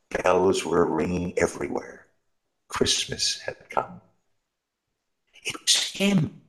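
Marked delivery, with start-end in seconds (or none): 5.4-6.4 high, marvelling words to himself; old shoemaker marvelling after the dream; old man to himself. Acted. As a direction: realise it in wonder. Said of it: "said the old man to himself".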